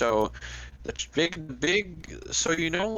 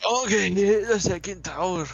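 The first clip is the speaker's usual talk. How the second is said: Shrek voice; 10 semitones higher than usual. Acted. Echoing Shrek voice.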